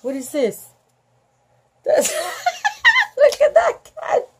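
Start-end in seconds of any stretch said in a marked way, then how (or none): 1.8-4.3 laughing through the words, pitched up. Real, not acted. Reader laughing at the cat picture, high giggly voice. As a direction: giggle while pointing at the cat.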